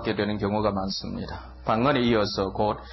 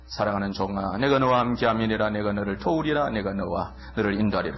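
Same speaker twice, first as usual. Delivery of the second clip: lower, solemn prophetic voice speaking as God; voice of God prophesying; 4 semitones lower than usual. Retold or imitated. Speaking to a beloved servant as God, low and solemn. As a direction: pronounce it like God's own word to his servant. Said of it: "그런데 예언은".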